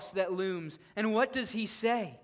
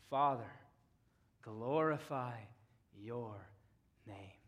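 soft reverent hush; 9 semitones lower, 7 dB quieter than usual. Real, not acted. Preacher softly voicing Jesus praying to the Father, hushed. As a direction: breathe the prayer out gently.

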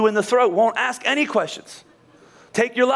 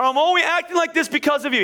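higher-pitched self-justifying protest; mock blame-shifting, pitched up; defensive excuse; 7 semitones higher than usual. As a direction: protest that it's their fault.

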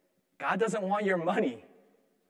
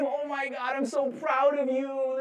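doting God; 8 semitones higher than usual. Pretend. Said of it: "God thinking we're cute".

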